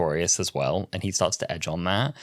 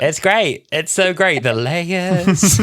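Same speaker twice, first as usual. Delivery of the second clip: loud, high delight breaking into laughter; real excited gushing; 9 semitones higher, 6 dB louder, much slower than usual. Real, not acted. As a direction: gush with giddy, laughing enthusiasm. Fans gushing over the show.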